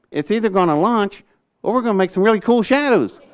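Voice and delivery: high, retold deadpan wisecrack; cheeky joke. Answering the worried colonel, joking. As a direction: toss off the punchline.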